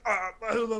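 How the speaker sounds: co-host's mock hype voice; hyped promo voice. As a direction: ham it up like an announcer. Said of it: "he went from talking, like, normal to talking, like".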